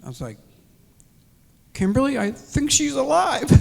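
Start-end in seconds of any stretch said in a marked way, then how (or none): 1.7-3.6 high, loud burst of relief; excited disbelief. Retold or imitated. Husband telling his daughter the news, excited high outburst. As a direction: blurt it out, overjoyed. News wife survived surgery.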